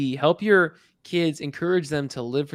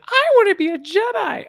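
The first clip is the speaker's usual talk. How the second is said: put-on high, loud whiny kid Aussie voice; mock whiny kid voice; 7 dB louder than usual. Host imitating young Jason begging, high whiny kid voice. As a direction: whine it like an eager little kid. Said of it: "when he's like".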